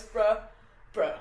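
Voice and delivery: loud, punchy bro; mock bro-speak. Shown as a demonstration. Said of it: "anytime I put on my, like, bro, bro voice".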